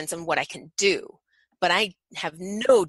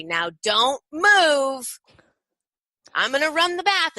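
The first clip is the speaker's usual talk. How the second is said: high, drawn-out mock-stern warning; mock playful warning; 7 semitones higher, 5 dB louder, slower than usual. Shown as a demonstration. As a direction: playfully warn the toddler not to move. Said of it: "mock playful voice".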